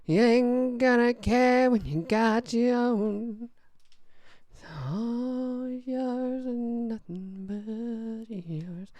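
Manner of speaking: crooning [name] impression, slow. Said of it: "a good old [name] kind of vibe".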